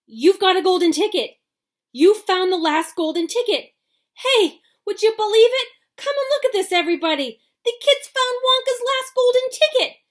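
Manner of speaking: high, loud, frantic yelling; excited shouting. A narrator's reading. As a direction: shout it to the whole shop. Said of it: "screamed the shopkeeper".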